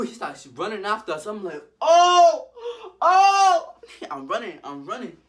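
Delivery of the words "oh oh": high, loud, drawn-out mock scream; comic wail of alarm; panicked scream. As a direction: scream as if being chased. Man starts chasing them.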